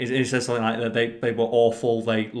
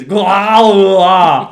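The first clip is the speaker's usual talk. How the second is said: much higher and much louder action-hero yell; Arnold Schwarzenegger bellow; 8 semitones higher, 13 dB louder than usual. Retold or imitated. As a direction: boom out a movie catchphrase parody. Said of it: "one of his most famous quotes is".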